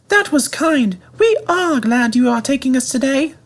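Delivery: high, fussy coach voice; coaches. A narrator's reading. Coaches warning Edward not to bump them, high and fussy. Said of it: "said the coaches".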